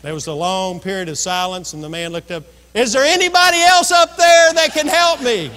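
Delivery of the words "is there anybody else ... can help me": high, loud comic wail; man on the limb begs for another rescuer, loud; desperate punchline plea. Retold or imitated. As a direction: beg for anyone but him. Hanging man refuses to let go.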